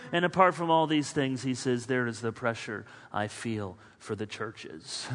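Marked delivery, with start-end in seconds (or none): 1.9-5.1 quiet weary quote. Acted. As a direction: drop the voice, softer, lower. Quoting Paul softly, sighing.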